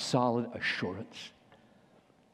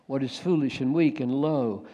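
normal talking voice

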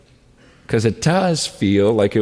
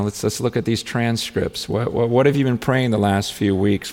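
usual speaking voice

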